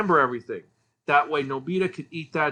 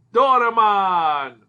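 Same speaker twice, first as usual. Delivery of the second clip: loud, drawn-out announcement of the name; slow, booming big reveal; excited title reveal; 3 semitones higher, 8 dB louder, much slower than usual. Real, not acted. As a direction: belt out the title like a game-show reveal. Dramatic reveal of reviewed series.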